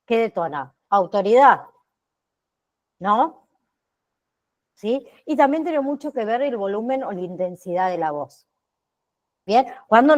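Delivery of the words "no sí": normal talking voice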